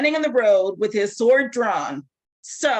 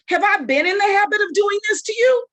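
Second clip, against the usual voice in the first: exasperated shout; 10 semitones higher than usual. Acted. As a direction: throw the question back at him hard. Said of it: "It had some attitude".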